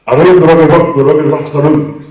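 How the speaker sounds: loud Scottish tongue twister with a rolled r; Scottish accent. Shown as a demonstration. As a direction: roep het in een Schots accent. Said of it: "If you're Scottish, you understand this".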